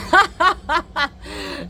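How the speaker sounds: a burst of high laughter; sudden high laugh. Real, not acted. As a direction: laugh out loud, high and bright. Laughs at partner's joke.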